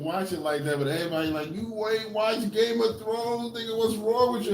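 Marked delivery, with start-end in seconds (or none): 1.5-4.5 nagging fan. Retold or imitated. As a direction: play the friend shocked you haven't watched it. Imitating everyone nagging about Game of Thrones.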